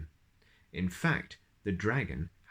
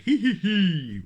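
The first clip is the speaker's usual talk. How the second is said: higher, louder wheezy giggle; wheezing giggle; 10 semitones higher, 7 dB louder than usual. Acted. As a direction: giggle out of breath. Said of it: "wheezed the dragon".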